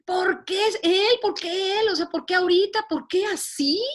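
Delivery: anguished crying out. Retold or imitated. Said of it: "me metí en el carro ... a gritar".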